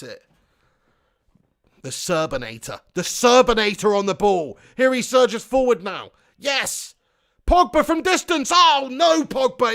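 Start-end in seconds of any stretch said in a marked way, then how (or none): 2.9-9.7 loud, high football commentator build-up; raised-voice live match excitement; excited commentary. Real, not acted. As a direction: call the shot with rising excitement, then dismay.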